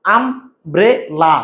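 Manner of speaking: আমব্রেলা drawn out very slowly, syllable by syllable. Shown as a demonstration. Teacher slowly sounds out আমব্রেলা for students. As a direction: stretch আমব্রেলা out slowly, one syllable at a time. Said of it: "তিনটা অক্ষর".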